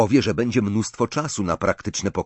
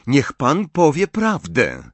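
higher, louder order to an adult; forceful command; 6 semitones higher, 5 dB louder than usual. A narrator's reading. Distrustful boy orders Dumbledore to be honest, commanding. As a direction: demand it as if used to being obeyed. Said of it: "Ostatnie dwa słowa wypowiedział z taką mocą".

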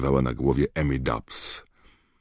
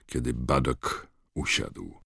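usual speaking voice

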